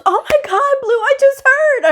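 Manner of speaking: very high, loud, gushing imitation; excited gossip. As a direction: burst in with hammed-up thrilled news. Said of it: "this lady was so excited".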